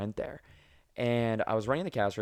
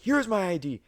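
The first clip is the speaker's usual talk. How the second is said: much higher, louder mock-angry voice; angry customer; 10 semitones higher, 4 dB louder than usual. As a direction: shove it in the cashier's face. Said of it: "He's like".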